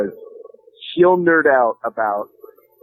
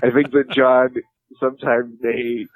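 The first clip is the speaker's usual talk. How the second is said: usual speaking voice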